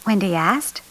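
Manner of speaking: plain reading voice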